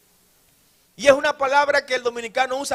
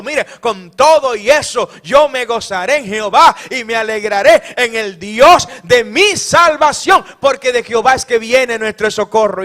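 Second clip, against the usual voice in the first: full-force preaching; 2 semitones higher, 11 dB louder than usual. Acted. Preacher whips the congregation into loud joyful praise. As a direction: preach it out to the rafters with joy.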